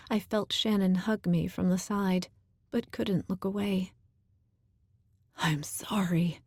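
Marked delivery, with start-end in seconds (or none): 5.4-6.4 breathless whispered apology; gasped whisper. Acted. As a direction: gasp it out on the breath. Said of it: "Danny gasped".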